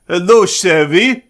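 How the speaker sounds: slow, lightly voiced Cajun accent; a hint of Gambit's Cajun drawl. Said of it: "his, like, ridiculous Cajun accent".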